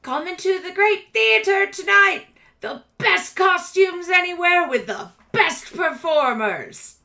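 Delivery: loud, high carnival-barker pitch; showy theater promoter. Acted. Rat boy touting tonight's theater to shoppers, loud high hawking. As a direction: belt it out to draw a crowd. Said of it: "he looks up to you and goes".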